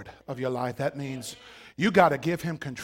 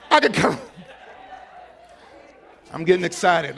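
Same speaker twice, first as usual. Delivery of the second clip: normal talking voice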